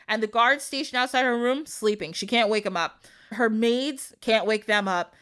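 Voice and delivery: ordinary delivery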